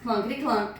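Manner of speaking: usual speaking voice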